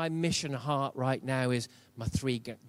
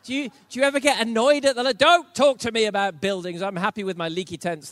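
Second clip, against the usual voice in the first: annoyed outburst; 8 semitones higher, 11 dB louder than usual. Real, not acted. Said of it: "I've been annoyed all night".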